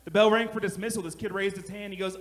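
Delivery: normal talking voice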